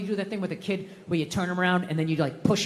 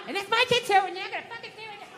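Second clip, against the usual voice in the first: high-pitched imitation of a wife ranting on; mock spousal tirade; furious wife impression; 10 semitones higher than usual. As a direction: keep scolding as the angry wife, up high. Wife continues berating, acted.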